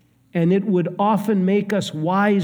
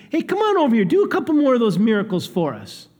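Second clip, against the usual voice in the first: much higher, quick, cheeky call-out; mocking crowd heckle; 6 semitones higher, faster than usual. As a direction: taunt him to do another trick. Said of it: "It's like what they did to Jesus".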